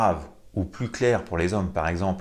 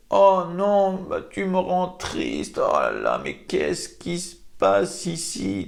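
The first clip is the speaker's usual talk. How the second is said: hammed-up sad wail, much higher; mock sadness; 10 semitones higher, slower than usual. Shown as a demonstration. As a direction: overplay sorrow, badly on purpose. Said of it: "Ensuite, de la tristesse". (tristesse surjouée, beaucoup plus aiguë)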